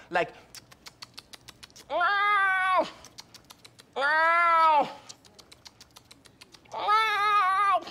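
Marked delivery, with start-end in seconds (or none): 1.9-7.9 high hammed-up ow cries; mock pained yelps. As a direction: yelp like it hurts, but play it up. Acted pained cries in the retelling.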